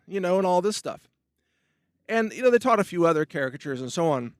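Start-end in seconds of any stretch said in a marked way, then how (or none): none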